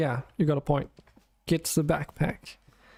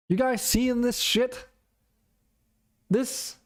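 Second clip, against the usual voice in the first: higher, incredulous call to chat; surprised outcry; 8 semitones higher than usual. Real, not acted. Streamer calling chat's attention to a sneaky enemy, incredulous. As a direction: pitch up in disbelief at what you spot. Spotting hidden enemy in bushes.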